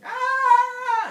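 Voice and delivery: very loud, high, drawn-out scream; full-out shriek of horror; villagers' scream. Retold or imitated. Villagers seeing inside the house, long high scream. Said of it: "villagers start screaming".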